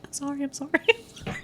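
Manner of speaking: tiny high, soft voice; retold frantic apology. Friend retelling pushing through giant crowd, mock meek apology. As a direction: squeak apologies in passing, half laughing.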